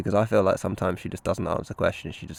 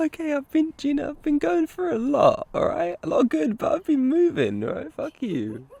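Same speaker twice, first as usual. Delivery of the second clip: high mock-defensive whine; 7 dB louder than usual.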